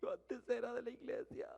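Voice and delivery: tearful confession. Retold or imitated. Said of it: "Y empieza a llorar, pero de una manera dramática".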